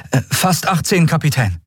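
put-on high, hesitant boy voice; boy answering captain.

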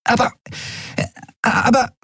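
high, stuttering false starts; lost for words; stammering. A narrator's reading. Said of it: "Nelen kam ins Stottern". (stotternd, hoch)

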